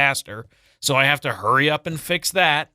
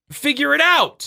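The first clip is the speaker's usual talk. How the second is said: exasperated yell; 12 semitones higher, 5 dB louder than usual. Retold or imitated. Exasperated retold dad.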